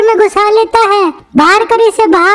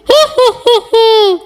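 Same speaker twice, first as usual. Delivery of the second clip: witch giggle; slower than usual. Acted. Witch laughs, threat. (ऊँची ही ही हँसी)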